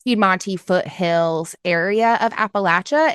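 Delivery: ordinary delivery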